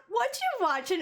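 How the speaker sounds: mock quoting the character; mocking imitation. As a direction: imitate the way he said it, teasing. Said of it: "Why are you talking like that?".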